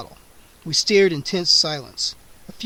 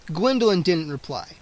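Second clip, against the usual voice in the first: ordinary delivery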